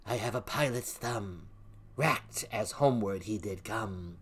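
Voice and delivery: ordinary talk, nothing marked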